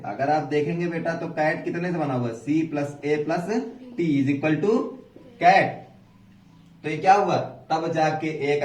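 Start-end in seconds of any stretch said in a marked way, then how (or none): 2.3-5.7 letter by letter, very slow; slow spelling out. Shown as a demonstration. Teacher spelling cat for pupils, drawn out slowly. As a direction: spell it out one letter at a time. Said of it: "spelling out a word".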